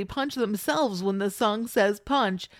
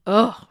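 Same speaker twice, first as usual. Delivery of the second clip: disgusted groan; 9 dB louder than usual. Retold or imitated. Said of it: "Raven says".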